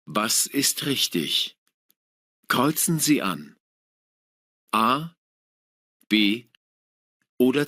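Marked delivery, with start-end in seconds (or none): none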